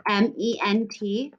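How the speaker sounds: ordinary delivery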